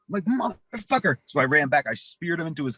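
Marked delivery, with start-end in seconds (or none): 0.7-1.2 high, spat-out curse; mock angry curse. To cousin before spearing him, angry pitched-up curse. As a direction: snap before charging back in. Narrator snaps before charging back.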